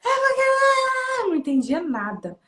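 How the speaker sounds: high, drawn-out garbled wail; mock child's bawling. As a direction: imitate a crying child's unintelligible blubbering. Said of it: "ele começou a chorar".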